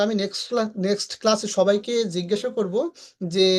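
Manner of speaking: normal talking voice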